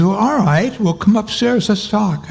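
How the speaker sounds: a hint of an eager teenage boy. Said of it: "he sounded really young".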